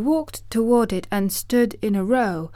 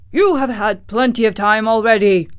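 loud, indignant Tin Woodman; angry; 3 semitones higher, 7 dB louder than usual. A narrator's reading. Tin Woodman confronts the stalling wizard, angry. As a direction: protest angrily, raising the voice. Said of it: "said the Tin Woodman angrily".

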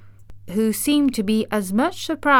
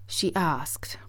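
ordinary delivery

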